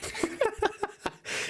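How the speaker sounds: a high, airless burst of laughter; high breathless laugh. Real, not acted. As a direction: crack up at your own story. Laughing at own fan fiction.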